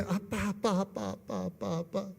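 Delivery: little daughter. Retold or imitated. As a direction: repeat it softly, imitating a small child. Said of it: "이렇게". (어린 딸이 계속 부르는 흉내, 여리게)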